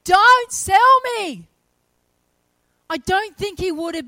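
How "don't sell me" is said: high, loud, slow cry of protest; desperate shout. Retold or imitated. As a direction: shout it back as he's dragged away. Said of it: "he's shouting back".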